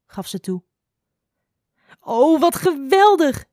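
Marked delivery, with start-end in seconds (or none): 2.0-3.5 high, loud, drawn-out enthusiasm; delighted cry. A narrator's reading. Doeltje hears about the firefly painting, delighted cry. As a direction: gush with slow, soaring delight. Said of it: "riep Doeltje".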